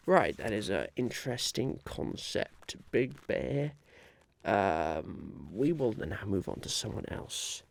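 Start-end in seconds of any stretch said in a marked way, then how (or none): none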